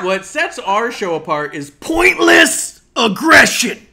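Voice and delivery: loud, slow, high promo shout; wrestling promo shout. Acted. Hyped wrestling catchphrase again.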